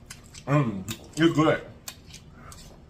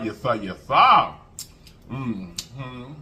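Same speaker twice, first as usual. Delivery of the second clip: ordinary delivery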